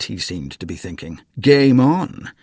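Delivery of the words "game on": louder, relishing a fight; challenging. A narrator's reading. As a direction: accept the challenge with glee. Grandpa thinking.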